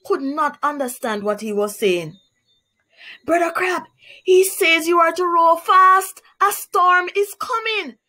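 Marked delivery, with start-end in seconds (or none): 3.3-8.0 high and loud into an ear; bellowing. Acted. Anansi shouting into Crab's ear, high loud bellow. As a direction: yell it over the wind. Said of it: "bellowed Anansi into Crab's ears".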